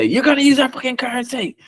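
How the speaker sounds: high-pitched mock foreign invader; hammed-up high-pitched threat. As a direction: demand it in a high put-on voice. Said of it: "put guns to people's heads and say".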